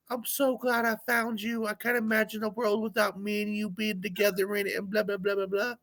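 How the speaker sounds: high, soft, syrupy love-note parody; mock gushing. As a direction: gush sweetly, then trail into blah-blahs. Mocks gushy love messages he receives.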